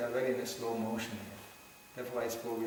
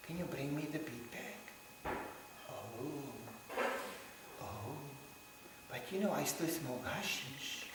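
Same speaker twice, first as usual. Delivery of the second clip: much softer, slow and hesitant; lightly voiced shy seeker; soft mumbling newcomer; 11 dB quieter, slower than usual. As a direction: mumble it sheepishly. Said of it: "And he goes".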